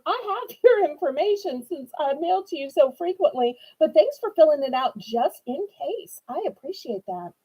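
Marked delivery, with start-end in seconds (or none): none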